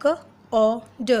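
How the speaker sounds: slow, letter by letter; sounding out letters. Shown as a demonstration. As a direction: sound out each letter slowly. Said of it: "उसी तरीके से".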